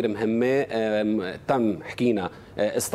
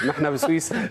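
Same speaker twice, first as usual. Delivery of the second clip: laughing, higher-pitched quip; 5 semitones higher than usual. Real, not acted. Guest tells the host all is fine here, laughing. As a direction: say it through a laugh. Joking reply after being asked why angry.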